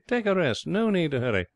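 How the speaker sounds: high, indulgent reassurance; airy, kindly tone. A narrator's reading. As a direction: soothe with no rush at all. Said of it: "he added kindly".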